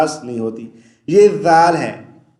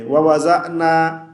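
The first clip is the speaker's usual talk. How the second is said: ordinary talk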